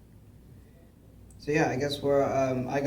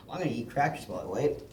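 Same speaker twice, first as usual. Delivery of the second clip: usual speaking voice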